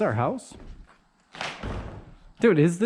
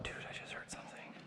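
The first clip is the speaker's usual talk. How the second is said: frightened whisper; 24 dB quieter than usual. Real, not acted. Hears a noise, frightened.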